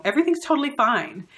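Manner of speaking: bright, quick "totally fine" that means the opposite; mock cheerful denial; fake fine. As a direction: chirp it so it sounds forced. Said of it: "They might say".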